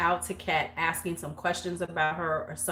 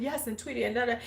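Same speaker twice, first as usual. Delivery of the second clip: mock British accent, lightly pitched up; 4 semitones higher, 6 dB quieter, much faster than usual. Friend talking to her on the phone, mock British accent. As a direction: put on a little British accent. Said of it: "talking in this British accent".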